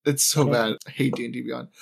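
ordinary delivery

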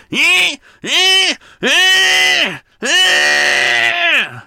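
high, loud droning eh like a grinder; imitated angle grinder. Vlogger imitating neighbour's angle grinder, loud high droning. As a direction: blast out a grinding machine noise. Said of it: "he was".

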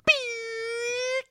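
very high, thin electronic whine; goggle sound impression. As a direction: squeal out the night-vision goggles turning on. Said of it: "It sounds like this".

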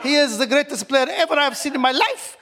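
put-on Arabic accent. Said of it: "keep talking in the Arabic accent".

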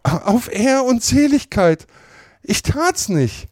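hoch, langsam, schluchzend; verzweifelt beteuernd. A narrator's reading. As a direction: fleh langsam um Glauben. Potter beteuert weinend Unschuld. (Potter beteuert schluchzend, hoch und langsam)

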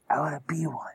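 whispering. Acted. Creepy confession of wanting death.

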